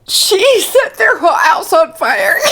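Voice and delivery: high words through laughter; cracking up while telling; laughing. Real, not acted. Laughing fit continues.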